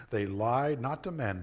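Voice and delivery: ordinary delivery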